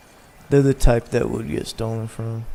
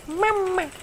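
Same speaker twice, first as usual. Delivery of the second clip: tiny falsetto, much higher and softer; squeaky high mama; 4 dB quieter than usual. Pretend. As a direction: squeak it out like a baby voice. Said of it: "you gotta say it like that".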